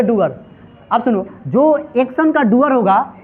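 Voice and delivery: usual speaking voice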